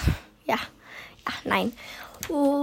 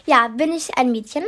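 usual speaking voice

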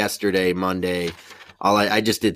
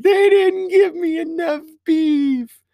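slow, high, drawn-out hammed-up wail; mock crying; 5 dB louder, slower than usual. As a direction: imitate a sobbing customer, stretching every word. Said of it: "hear the one start to cry".

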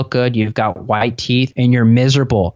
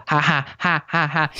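put-on soft, higher giggly "Ha, ha, ha" like a toddler; imitating baby laugh; 4 semitones higher, 4 dB quieter than usual. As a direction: mimic the little girl's playful laugh. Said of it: "and just start laughing".

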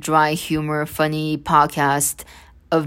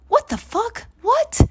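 much higher, stunned cry of disbelief; real shock, pitch leaping up; disgusted disbelief. Real, not acted. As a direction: squeal it out, appalled at what he said. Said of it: "that just disgusted me".

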